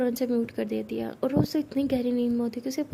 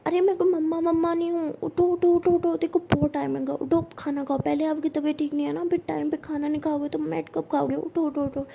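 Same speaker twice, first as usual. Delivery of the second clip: higher, louder coaxing chant; coaxing wake-up; 4 semitones higher, 4 dB louder than usual. Acted. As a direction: rouse her sleepily but insistently. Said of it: "तो अनोखी कहती".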